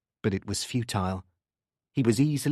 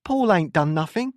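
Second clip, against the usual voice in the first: raised, high-pitched protest; 8 semitones higher, 5 dB louder than usual. A narrator's reading. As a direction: defend your son loudly. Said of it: "she protested".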